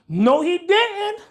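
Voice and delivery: high, loud and drawn-out no he didn't; mock astonishment. Mocking his own failed rack, drawn-out shocked exclamation. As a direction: play up the shock like a sassy onlooker.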